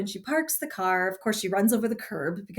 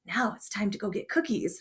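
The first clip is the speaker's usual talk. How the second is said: normal talking voice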